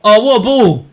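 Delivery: high, loud, amazed exclamations; excited live reaction. Real, not acted. Fan watching the keeper cross the ball, excited. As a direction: exclaim each bit as it happens.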